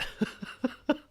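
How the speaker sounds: high, breathy laughter; a long wheezy chuckle at your own remark; self-amused laugh. Real, not acted. Podcaster admitting he's in the bar too, self-amused laugh.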